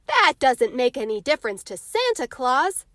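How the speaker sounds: put-on boy scoffing at his older sister. A narrator's reading. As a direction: brush the doubt off with a scoff. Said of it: "scoffed Jimmy".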